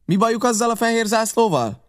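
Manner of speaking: high, sharp question; captain questioning the pirates outside; captain's demand. A narrator's reading. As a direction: challenge them about the flag. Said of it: "kérdezte".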